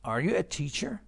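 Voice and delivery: high question tune ending up on "teacher"; rising intonation. Shown as a demonstration. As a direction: lift "teacher" at the end. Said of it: "پس تون صدا میره بالا".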